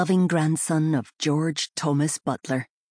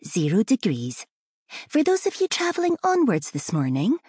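sing-song announcer voice; 6 semitones higher than usual. Acted. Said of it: "The flight attendant had one of those sing-song voices".

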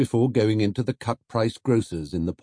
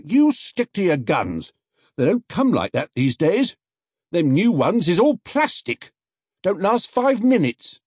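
higher cockney-ish market voice urging him on; gruff onlooker; 11 semitones higher, 5 dB louder than usual. Acted. Onlooker urging Paddington to stick to his guns, higher. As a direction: back him up firmly.

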